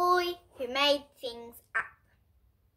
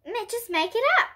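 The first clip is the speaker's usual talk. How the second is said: put-on eager boy's cry; 9 semitones higher, 4 dB louder than usual. A narrator's reading. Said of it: "Michael cried".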